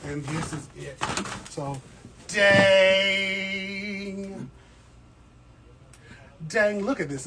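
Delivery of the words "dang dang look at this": amazed. Real, not acted. Sees the huge new pot.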